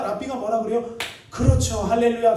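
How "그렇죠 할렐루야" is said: delighted approval. Retold or imitated. Rabbi happily approves smoker's prayer.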